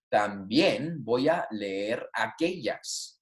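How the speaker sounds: slow, spaced-out Spanish sentence; slow model reading. Shown as a demonstration. Teacher repeating the answer for students, slow model reading. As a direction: repeat it slowly so learners follow.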